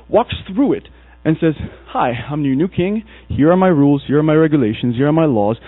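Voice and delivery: ordinary talk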